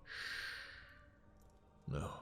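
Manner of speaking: soft unvoiced shush; a breathy shh to silence protest; hushing. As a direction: hush them gently. Hushing lover mid speech.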